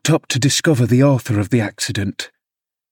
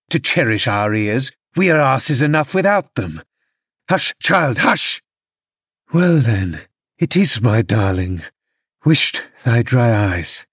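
a hint of a drunk father threatening his child; mild drunken father rage; 3 semitones higher than usual. Drunk father snapping at his crying son, lightly voiced.

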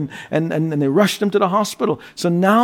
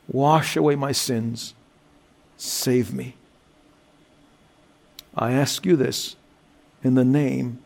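led prayer; 5 semitones lower than usual. Acted. Pastor leading listeners in prayer, hushed and low.

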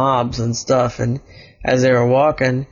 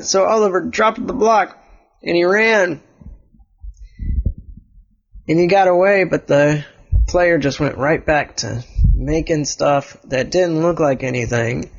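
ordinary delivery, nothing marked

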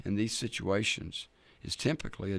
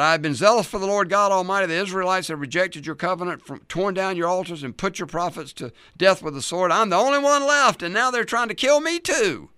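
desperate plea; 9 semitones higher, 8 dB louder than usual. Acted. Elijah's desperate complaint to God.

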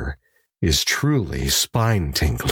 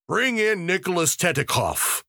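higher call to the guards; crying out; 11 semitones higher than usual. A narrator's reading. Magistrate calling for the prisoner to be brought in, higher call. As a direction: call the prisoner in, raised pitch. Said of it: "cried the examining magistrate".

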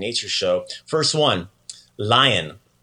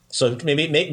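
usual speaking voice

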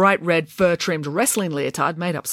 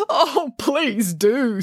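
mock sobbing; 6 semitones higher, much slower than usual. A narrator's reading. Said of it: "sobbed the manager".

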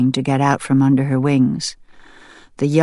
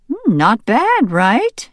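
high, slow, musing wife voice; farmer's wife asking; 9 semitones higher, slower than usual. Acted. Farmer's wife asking her husband, high and musing. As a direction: muse it over, high. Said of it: "his wife asked".

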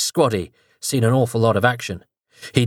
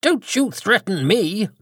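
much higher, slow indignant defiance; voice rising an octave; 10 semitones higher, slower than usual. Acted. Prime Minister defies the possessing voice, voice jumping high. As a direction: squeak out defiance. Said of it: "his voice rising a full octave".

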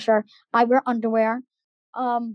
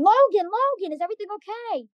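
high-pitched imitation of a concerned aide; worried aide calling out; 9 semitones higher than usual. Aide running after an upset boy to check on him, worried high call.

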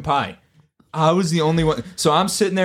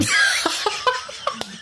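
airy high-pitched burst of laughter; real laugh cracking up; high breathy laugh; 3 dB quieter than usual. Real, not acted. Teller laughs at own story.

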